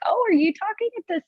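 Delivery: high-pitched mock-surprised question; imitating surprised patient. Patient questions audiologist's volume.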